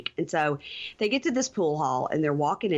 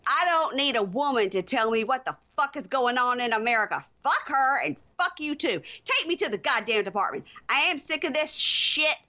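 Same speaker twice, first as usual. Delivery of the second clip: high-pitched, indignant cursing; heated, voice pitched up; angry rant; 7 semitones higher than usual. Acted. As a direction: act out a fed-up woman tearing into her partner. Acted furious rant in the car.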